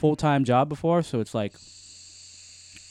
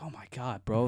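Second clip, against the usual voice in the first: fake reaction; 9 dB quieter than usual. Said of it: "I got a fake laugh to this".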